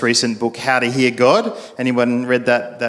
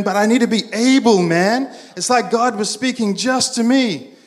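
high-pitched, earnest amazement; hammed-up awe at a personal revelation; excited friend; 7 semitones higher than usual. Friend explains what the message meant to him, acted excitement.

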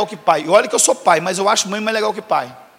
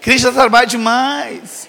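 slow, drawn-out shout on trabalho; slow mock shout; much slower than usual. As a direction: belt it out slowly, exasperated. Repete a frase gritando devagar. (grito lento e exagerado: "Crente dá trabalho demais")

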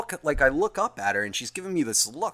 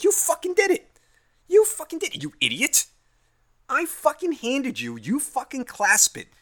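much higher, loud exasperated rant; mock yell at the coworker over the dropped lunch; mock furious rant; 13 semitones higher, 4 dB louder than usual. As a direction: tear into her, hammed-up anger.

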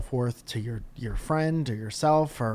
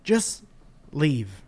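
exasperated rant; 6 semitones higher, 4 dB louder, much slower than usual. Real, not acted. Venting at the nerds.

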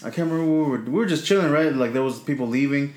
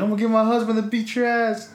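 high-pitched imitation of a threatening woman; retold angry threat; 9 semitones higher than usual. Lady threatens teenager with her husband, high mock-angry voice.

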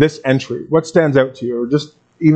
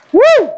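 very high, loud whoop; cheering whoop; 6 dB louder than usual. Real, not acted. Pastor cheering the chanting congregation, loud high whoop.

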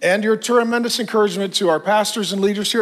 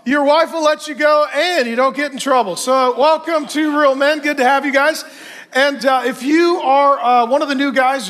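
excited welcome; 6 semitones higher than usual. Real, not acted. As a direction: fire up the room of men. Pastor hyping up the crowd.

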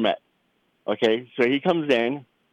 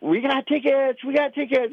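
put-on excited kid cheer; 13 semitones higher than usual. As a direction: imitate a thrilled kid celebrating in a high voice. Acting out the child's excited cheer.

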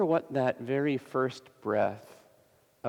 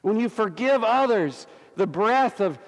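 high-pitched, driving sermon delivery; impassioned preaching; 8 semitones higher, 4 dB louder than usual. Real, not acted. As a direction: preach it with full fervour, pitch raised.

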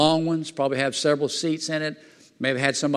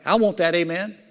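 ordinary talk, nothing marked

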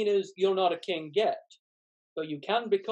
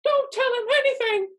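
squeaky gingerbread man; 6 dB louder than usual. Acted. Gingerbread man warning the mirror.

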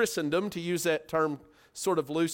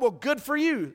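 sarcastic; 5 semitones higher than usual. Shown as a demonstration. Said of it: "I like this phrase".

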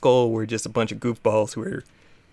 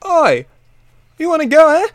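much higher, louder mock British taunt; hammed-up cockney "Oi, you wanna go"; mock British challenge; 8 dB louder than usual. Gamer voicing grunts picking a fight with Hop, mock British taunt. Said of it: "and you're like".

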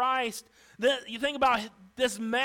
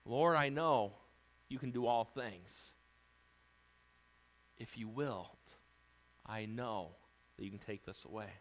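much softer, lower, submissive plea; humble prayer; 11 semitones lower, 9 dB quieter than usual. Shown as a demonstration. Leper humbly praying to the Lord, soft and low. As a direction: pray it gently, yielding. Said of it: "the right way to approach the Lord".

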